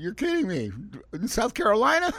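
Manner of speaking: high, loud, incredulous "in South Carolina?"; retold disbelief. Host retelling hearing of a listener far away, astonished. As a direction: act amazed at the news, pitch jumping up. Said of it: "I says".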